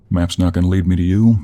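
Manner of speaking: loud, irritated retort; exasperated. Acted. Exasperated, repeats the complaint.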